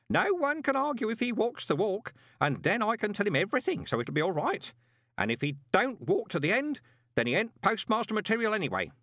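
high-pitched, animated reasoning; scheming postman. A narrator's reading. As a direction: convince yourself of the plan, pitched well up. Postman reasons out his plan.

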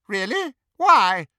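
high, imperious questioning; haughty challenge. A narrator's reading. Hermione posing as haughty Bellatrix.

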